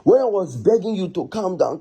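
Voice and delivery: ordinary talk, nothing marked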